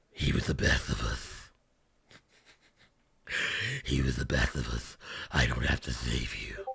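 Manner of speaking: mocking the gravelly movie Batman. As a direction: rasp it deep and menacing like Bale's Batman.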